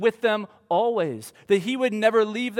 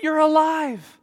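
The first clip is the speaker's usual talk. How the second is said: high, louder and slowing, overwhelmed; joyful disbelief; 7 semitones higher, 7 dB louder, slower than usual. Retold or imitated. Mary realizing Jesus is alive, overjoyed and loud. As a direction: let the joy break through in disbelief. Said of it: "she can't believe it".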